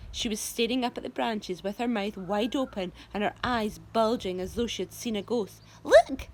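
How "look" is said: high, loud astonished look; shocked cry. A narrator's reading. Aunt Spiker seeing the peach grow. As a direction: gasp it at her sister. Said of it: "she said".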